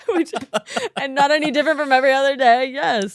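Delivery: quick, laughing tease. Real, not acted. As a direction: giggle it out fast. Teasing through laughter.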